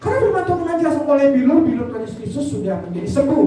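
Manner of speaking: high, insistent sermon proclamation; fervent preaching. Real, not acted. Preacher promising healing to the sick, fervent preaching. Preaches scripture at full force.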